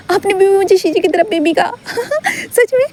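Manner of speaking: put-on high, giggly little-boy voice; a small boy tickled at being called baby; delighted child. Wing laughs that Gaga called him baby, high giggly child voice.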